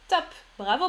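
normal talking voice

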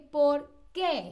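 "por qué" with weight on a falling "qué"; stress on qué. Shown as a demonstration. Teacher demonstrates the question word for students, weighted "qué". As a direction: land heavily on "qué", apart from "por". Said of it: "tiene como este peso".

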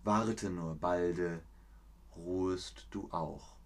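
slow, hushed recital with long pauses between phrases; poem recitation. Shown as a demonstration. Reciting Goethe to the stream, slow and hushed. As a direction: let each line settle and fade softly at the end. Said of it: "Ich rezitiere es nochmal".

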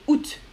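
août in one syllable, T sounded; août as "oot". Shown as a demonstration. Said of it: "La plus habituelle".

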